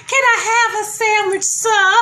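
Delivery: high, timid begging squeak; pleading high voice. Retold or imitated. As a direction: beg politely in a small high voice. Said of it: "I was like".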